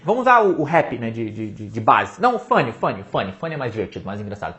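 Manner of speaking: ordinary talk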